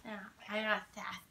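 whisper. Real, not acted. Quick whispered aside.

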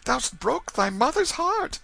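very high-pitched grieving mother; tearful yearning. A narrator's reading. Mother kneeling before her boy, tearful lament. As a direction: lament in a high, pleading wail. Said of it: "gazed yearningly into his face through her rising tears".

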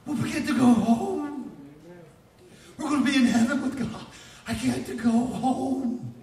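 high, loud, jubilant wail; tears of joy. Acted. Preacher imagining believers arriving in heaven, joyful crying. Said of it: "that's the kind of tears it'll be".

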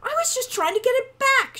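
very high, loud indignant protest. A narrator's reading. Wren defending herself to Hawk, indignant. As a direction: defend yourself in a high, wounded cry. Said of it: "went wide with indignation".

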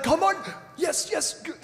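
put-on high, eager accented urging; put-on accent, high and insistent; imitating Reinhard. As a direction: mimic the evangelist egging someone on. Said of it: "or something like that".

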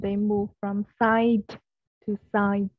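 "they move from side to side" very slowly, every final consonant sounded; slow model reading with crisp word endings; clear final sounds. Shown as a demonstration. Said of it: "Âm cuối đấy".

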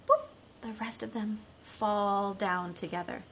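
usual speaking voice

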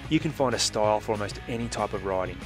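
normal talking voice

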